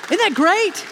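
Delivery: high, delighted exclamation; excited. Real, not acted. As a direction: burst out with joy. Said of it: "excited delivery".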